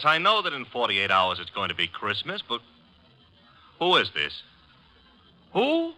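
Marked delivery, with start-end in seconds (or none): 5.5-5.9 startled. Acted. Startled by the caller's answer.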